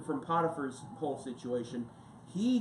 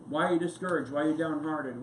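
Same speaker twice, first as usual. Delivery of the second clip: normal talking voice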